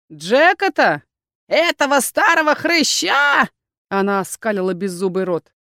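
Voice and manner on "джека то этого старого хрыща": high, slow, shouted crone voice; mocking crone. A narrator's reading. Old crone mocking shopkeeper Jake, high shout. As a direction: jeer at the old fool.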